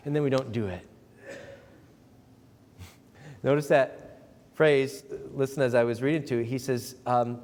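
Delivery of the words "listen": ordinary talk, nothing marked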